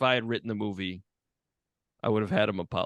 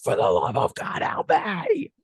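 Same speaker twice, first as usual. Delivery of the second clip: desperate plea for help; 13 semitones higher, slower than usual. Acted. Trapped consciousness of someone possessed by Santa, mock-desperate cry. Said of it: "your consciousness is just, like, shoved in the back".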